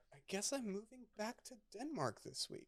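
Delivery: small, high, soft voice; mock-timid resignation; meek giving in. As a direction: give in sheepishly, high and quiet. Retold meek surrender after protesting.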